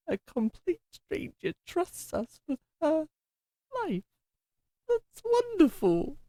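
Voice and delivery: overjoyed. Acted. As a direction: let the joy lift your voice way up. Moved that a stranger trusts him.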